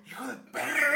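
loud, high mock curse at the bully. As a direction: yell it in hammed-up outrage. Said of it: "Como yo".

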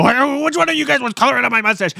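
high, fast accusing voice; mock indignant lady. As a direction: play the lady catching out the kids. Lady catches kids, questions them.

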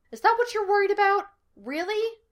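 high, quick disbelieving question; rushed, high-pitched disbelief; incredulous. A narrator's reading. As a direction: jump way up in pitch, astonished. Incredulous reply in acted quarrel.

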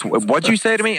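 imitating a fighter who bristles; mock-offended what'd you say; indignant challenge. Nick bristling at the insult.

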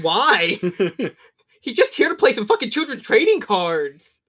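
loud, high-pitched incredulous rant; mock exasperated outburst. Viewer protesting at the film scene, mock exasperated. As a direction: blurt it out in comic disbelief.

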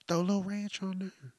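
ordinary talk

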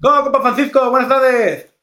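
high-pitched lisping Spanish imitation; ceceo Spanish. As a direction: imitate a friend who lisps his s. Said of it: "vamos a imitar un poquito".

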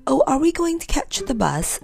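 put-on high-pitched, delighted question from a child; light up with eager pleasure; pleased. A narrator's reading.

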